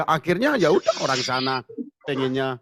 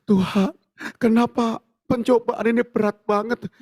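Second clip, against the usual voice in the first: whiny complaint; 7 semitones higher, 5 dB louder than usual. Retold or imitated. Believer complaining to God.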